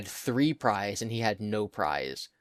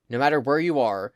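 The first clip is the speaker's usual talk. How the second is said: ordinary talk, nothing marked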